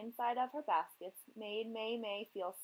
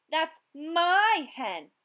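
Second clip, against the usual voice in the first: angry yell; 8 semitones higher, 11 dB louder, much slower than usual. A narrator's reading. Fisherman yelling after her.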